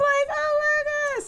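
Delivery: high, drawn-out damsel cry; put-on slow, high swoon; damsel in distress. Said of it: "in that damsel in distress voice".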